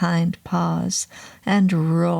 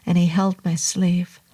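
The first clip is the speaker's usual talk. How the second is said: ordinary delivery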